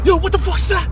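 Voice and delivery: sudden high fake startled yelp; mock alarm. Filming a dark road, fakes a scare for Poey. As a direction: pretend to spot something scary. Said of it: "Nah, I'm just joking".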